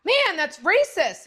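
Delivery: daughter voice indignant. Retold or imitated. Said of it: "And I went".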